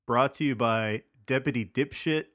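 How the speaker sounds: ordinary talk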